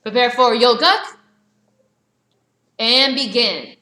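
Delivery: loud drill-hall call to start the form; called command. Real, not acted. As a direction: bark the start command to the class. Instructor calling out form command.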